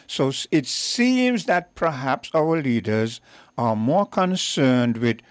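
usual speaking voice

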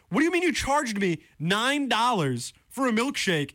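high, outraged question; host venting over a nine dollar milkshake; heated disbelief. Real, not acted. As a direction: demand an answer, voice way up. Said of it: "I'm a little heated".

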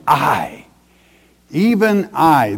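"I, even I" with weight on each "I"; emphatic. Acted. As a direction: draw out and punch each "I" in "I, even I". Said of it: "that's emphatic".

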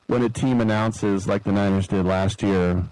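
ordinary delivery

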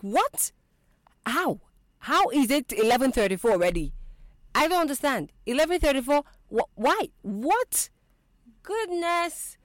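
high, loud mock shock; retold disbelieving outcry. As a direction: re-enact waking up in loud disbelief. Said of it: "I woke up and I was screaming".

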